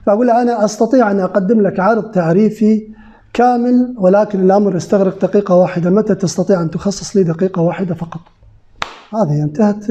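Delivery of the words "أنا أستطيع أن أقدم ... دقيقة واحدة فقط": formal fusha delivery of a sales invitation; Standard Arabic. Shown as a demonstration. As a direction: say it in careful classical Arabic, not dialect. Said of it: "أنا راح أقولها بالفصحى".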